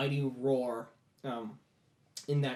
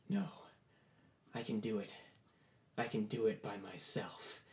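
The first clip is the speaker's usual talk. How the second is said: soft, lowered, halting insistence; quiet defiance; 4 semitones lower, 10 dB quieter than usual. Acted. Boy refusing to yield to the demon, quiet defiance. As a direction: insist softly, with pauses.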